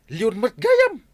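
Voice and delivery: alarmed exclamation. Acted. Telling his friend the dog is a lion, alarmed outburst. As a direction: burst out in alarm.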